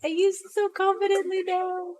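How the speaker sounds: high, sing-song brag; mock confident. Teasing her husband about the bridge, high mock boast.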